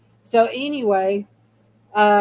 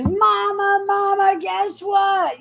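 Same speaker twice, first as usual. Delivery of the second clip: put-on a boy bursting with news to his mother; excited son calling; 11 semitones higher, slower than usual. Son running up to his mother, high excited call.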